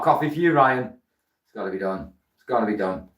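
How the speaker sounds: usual speaking voice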